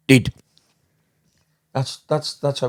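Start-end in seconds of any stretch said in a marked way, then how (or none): 0.1-0.3 put-on Bulgarian accent. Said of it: "like in a Bulgarian".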